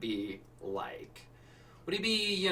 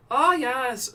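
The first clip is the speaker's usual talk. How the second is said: put-on higher-pitched stab at an Irish accent; attempted Irish accent; 10 semitones higher, 4 dB louder than usual. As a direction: try an Irish lilt, half-heartedly. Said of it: "I've never been good at an Irish accent".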